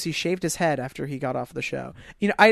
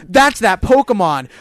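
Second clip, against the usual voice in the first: loud, slow, raised-pitch proclamation; mock announcer; 4 semitones higher, 9 dB louder, slower than usual. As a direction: announce the obvious like a cartoon host. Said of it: "That's what I imagine the show is now. Just like".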